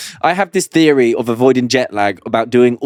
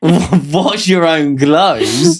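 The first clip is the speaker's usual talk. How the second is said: slow, drawn-out words through laughter; laughing retort; much slower than usual. Real, not acted. Partner teasing back about doing the laundry, laughing retort. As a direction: laugh as you tease them back.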